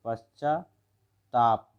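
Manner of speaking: पश्चाताप very slowly, pashchaa, pause, taap; word broken up. Shown as a demonstration. Teacher shows students the spelling, word broken up slowly. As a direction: say पश्चाताप in two drawn-out parts. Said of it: "double आधा ता नहीं".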